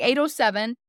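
plain reading voice

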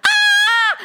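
high, loud shriek; piercing storybook scream; terrified scream. Acted. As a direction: shriek in hammed-up terror. Crowd screams at the monsters.

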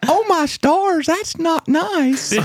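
high, slow scandalized gasp; prim shocked lady. Retold or imitated. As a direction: clutch your pearls, aghast. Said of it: "she was like".

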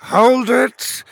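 high, loud wizard's command; calling after someone. A narrator's reading. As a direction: call out sharply to halt a fleeing king. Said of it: "Farlin called after him".

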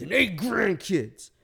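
mock outrage at the insult; old man's hammed-up indignant reply; offended outburst. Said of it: "he started shaking".

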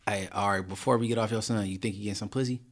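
usual speaking voice

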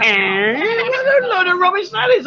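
high-pitched scoffing protest; mock disbelief. Real, not acted. Host teasing Ply who claims she's shy, mock disbelief. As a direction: shoot right up in pitch, calling her bluff.

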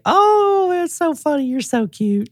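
high, softening gush; imitating a saleswoman's sweet phone charm; mock gushing "you're so cute"; sugary high Southern sweetness. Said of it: "she always will say".

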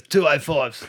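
put-on voice breaking into laughter; laughing imitation of the tyre guy; mock dodgy bloke. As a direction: proudly present the tyres like the seller. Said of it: "couldn't actually speak properly".

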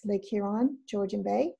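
normal talking voice

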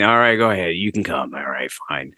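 a reluctant clerk letting him in; begrudging officials. Retold or imitated. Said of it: "so begrudgingly they signed".

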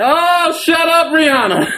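high, laughing snap at the song; laughing scold. Retold or imitated. Telling the song to shut up while laughing. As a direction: scold the singer through laughs.

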